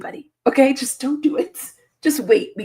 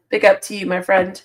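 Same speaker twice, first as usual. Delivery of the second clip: ordinary delivery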